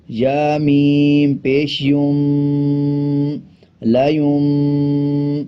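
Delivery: میم and یوم stretched with nasal hum; slow nasal recitation; ghunna on یوم. Shown as a demonstration. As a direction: draw out یا میم پیش یوم slowly through the nose. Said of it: "غنے کی آواز کو ناک سے نکالا جاتا ہے".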